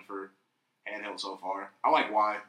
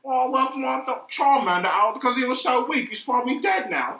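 high, loud, bad Australian accent; shitty Australian accent; 14 semitones higher, 8 dB louder than usual. Friend mimicking Charmander's old trainer, shitty Australian accent. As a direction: do a hammed-up, terrible Australian. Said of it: "he had, like, some shitty Australian accent".

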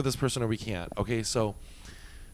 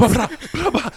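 punch sounds; 11 semitones higher, 6 dB louder than usual. Retold or imitated. Mimicking punches.

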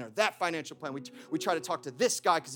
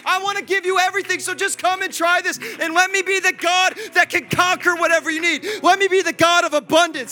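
higher, louder pleading voice of God; 10 semitones higher, 9 dB louder than usual. Acted. Pastor voicing God longing to bless his people, loud plea. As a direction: let God beg them to trust him. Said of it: "He's like".

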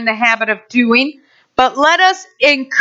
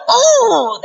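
high, drawn-out mock pained groan; 9 semitones higher than usual. Churchgoer feels the spur, high mock pained cry. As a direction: yelp as if pricked, hammed-up. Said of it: "that spur kinda hurt".

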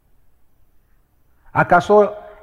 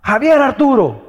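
mother calling; 6 semitones higher, 5 dB louder than usual. Retold or imitated. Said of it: "Yo escuchara la voz de mi mamá".

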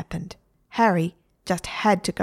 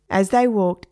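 ordinary delivery

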